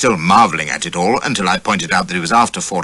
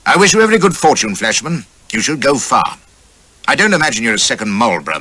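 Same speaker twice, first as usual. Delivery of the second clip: put-on sharp, higher, louder old general; Duke of Wellington; 6 semitones higher, 4 dB louder than usual. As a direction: briskly address a young officer like the Iron Duke. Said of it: "he looked sharply at me and says".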